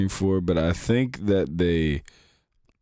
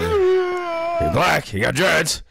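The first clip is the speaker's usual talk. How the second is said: laughing shout; 11 semitones higher, much slower than usual. Real, not acted. Teasing shout while laughing.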